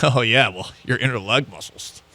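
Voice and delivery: talking down, a touch smug; condescending. Retold or imitated. Women at Pilates mocking the guy, a touch condescending. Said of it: "kind of like a talking down to".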